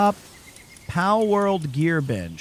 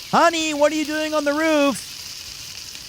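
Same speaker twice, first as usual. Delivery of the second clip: wife voice; 9 semitones higher than usual. Acted.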